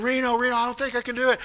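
soft, breathless pleading; a hint of a panicked, winded wife; breathless plea. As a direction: gasp it out like she can't get air. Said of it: "She can't breathe".

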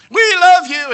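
high, loud, slow sing-song gush; mock gushing. Preacher mocking fickle church members, sugary mock gush. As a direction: parody their syrupy loyalty.

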